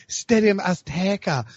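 hammed-up Spanish-accented stadium name; put-on Spanish accent. Mimicking a colleague's tweet about the stadium, Spanish accent. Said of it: "your, your, your, uh, Spanish accent".

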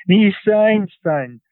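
put-on old woman calling out in a Central European accent, starting high; strong Central European accent. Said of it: "in a strong Central European accent".

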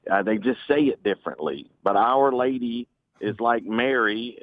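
ordinary talk, nothing marked

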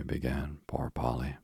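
usual speaking voice

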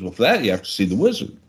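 low, fast fairy godmother. Acted. Fairy godmother voiced in joke.

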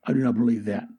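low whispered villain line; menacing whisper. Acted. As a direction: hiss it under the breath. Zastro answers in whisper.

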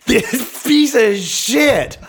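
loud, high, slow drawn-out indignation; mock outrage. Comic telling friends about the man who played them, mock outrage. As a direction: stretch every word out in outraged disbelief.

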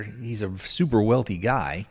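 ordinary talk, nothing marked

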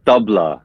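tabla said as TUH-bluh; native pronunciation. Shown as a demonstration. Musician correcting the host, tabla as TUH-bluh. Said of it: "tabla is how we would say it".

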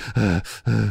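exaggerated winded panting; heavy breathing. Imitating Sid gassed during the match, heavy breathing. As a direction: huff like a gassed wrestler. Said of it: "how heavy he was breathing".

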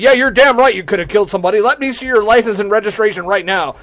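loud, high, fast scolding; imitating mad cop. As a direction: cut in like a furious traffic cop. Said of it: "he's mad".

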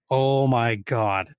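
slow, dragged-out mock despair; an exasperated hiker groaning at lost strangers, slow and drawn out; drawn-out oh my God. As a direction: let it sag in slow disbelief.

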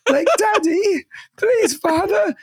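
high, pitiful mock plea; hammed-up helpless-child imitation; mocking brother. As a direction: beg in a teasing falsetto. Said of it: "my brother's like".

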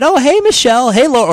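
loud, high imitated cheery greeting; retold phone caller. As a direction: greet like a family friend on the phone. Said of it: "and they said".

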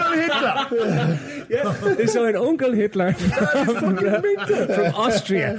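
high and slow through laughter; giggling while speaking; laughing. Real, not acted. Laughing at the made-up name.

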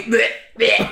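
high, loud, hammy dying gurgle; mock death gurgle. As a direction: gag out a fake death rattle. Mock death noises after joke.

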